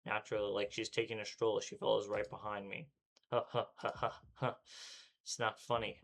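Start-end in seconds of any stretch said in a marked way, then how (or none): 3.3-4.5 panting. Acted. Exhausted panting.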